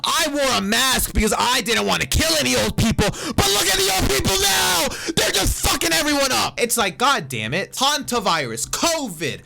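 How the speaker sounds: very high and loud throughout; furious shrieking rant. Real, not acted. Vlogger raging at boomers over covid, shrieking. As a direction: rage at full pitch, almost screaming.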